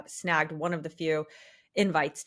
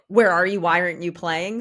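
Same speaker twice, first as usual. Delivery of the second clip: loud, mock-outraged questions; exasperated shout; 10 dB louder than usual. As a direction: call it out in disbelief. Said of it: "and it's like".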